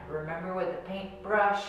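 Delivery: ordinary talk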